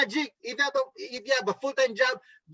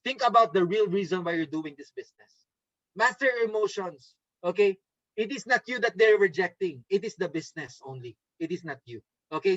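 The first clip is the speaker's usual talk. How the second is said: ordinary delivery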